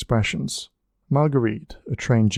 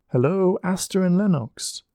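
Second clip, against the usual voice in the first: high, ringing call to the stone lions; yelled greeting; 8 semitones higher than usual. A narrator's reading. Margaret calls out to the library lions, yelling. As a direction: call out the names across the steps. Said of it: "Margaret yelled".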